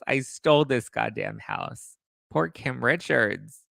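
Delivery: usual speaking voice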